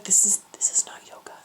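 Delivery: appalled whisper. Retold or imitated. Friend telling Carly the class is weird, appalled whisper. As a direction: confide it in disbelief, very hushed.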